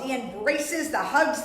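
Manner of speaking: plain reading voice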